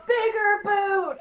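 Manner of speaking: loud, high, drawn-out cartoon yell; SpongeBob quote. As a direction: belt out a slow cartoon catchphrase. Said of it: "From SpongeBob".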